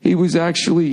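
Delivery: ordinary delivery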